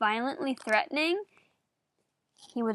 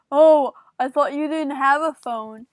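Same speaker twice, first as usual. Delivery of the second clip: louder, put-on accusing voice; mocking Frank's voice; 6 dB louder than usual. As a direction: imitate him catching you out. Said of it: "he's like".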